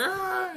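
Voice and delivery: very high, soft mock squeal; hammed-up little anime girl squeal. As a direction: squeal faintly in falsetto. Voices the sister's squeal.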